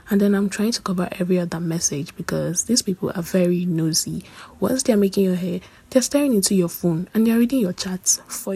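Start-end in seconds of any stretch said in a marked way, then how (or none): none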